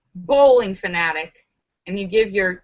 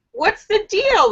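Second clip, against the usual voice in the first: quick, much higher bartender question; the bartender asks straight out, voice pitched up; 12 semitones higher than usual. Acted. Bartender asks the duck, quick and high.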